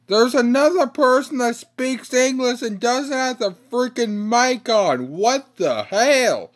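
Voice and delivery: loud, exasperated rant; frustrated yelling. Real, not acted. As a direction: yell at the silent teammate. Teammate without mic on.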